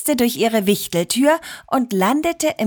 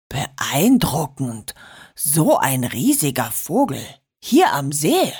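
murmured amazement; 6 semitones lower than usual. A narrator's reading. Dad watches a giant falcon circle, murmuring in awe. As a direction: marvel to yourself in a deep voice. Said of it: "murmelte er".

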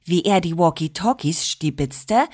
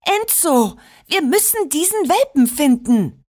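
higher voice calling to Enzo; 7 semitones higher than usual. A narrator's reading. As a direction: call him urgently, pitched higher. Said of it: "rief Georgina".

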